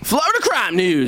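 high, punchy show-segment announcement. Acted. Podcast host announcing the crime segment, excited. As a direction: call out the segment title like a jingle.

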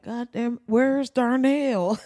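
mildly voiced puzzled fan, slow on the name; confused fan. Fans looking for absent players.

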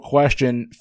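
normal talking voice

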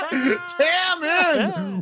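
high squealing laugh through the words. Real, not acted. Laughing hard at Tam's fifty-inch TV. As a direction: crack up at the joke.

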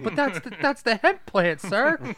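put-on high kid voice. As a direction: protest politely like a child. Kid voice answering grandpa.

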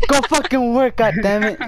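high, hammed-up angry command; mock parent order. Friend mimicking a parent ordering a child to work, mock anger. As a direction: order the kid to work, playing exasperated.

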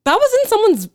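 high, loud half-laughing alarm; mock alarmed warning. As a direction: squeal the warning at him. Telling volunteer what he holds.

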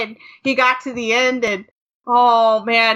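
ordinary delivery

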